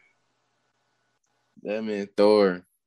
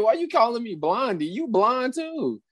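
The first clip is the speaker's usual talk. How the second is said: high mock indignation; 12 semitones higher than usual. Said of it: "I was like".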